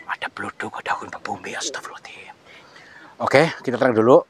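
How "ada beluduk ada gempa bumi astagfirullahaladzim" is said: soft hushed prayer; frightened whisper. Real, not acted. Host whispers a prayer during the tremor. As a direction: whisper in fear as the ground shakes.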